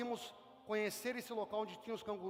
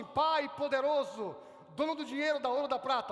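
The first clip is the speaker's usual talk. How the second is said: pompous address; 5 dB louder than usual. Retold or imitated. Pastor mocks flattering a father with titles, loud and pompous. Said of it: "Quem fala assim".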